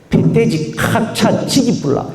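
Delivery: rough Gyeongsang dialect, laughing through it. Retold or imitated. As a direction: toss off a crude Gyeongsang line with a laugh. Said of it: "경상도 말 들어본 것 중에 제일 무식한 말은요".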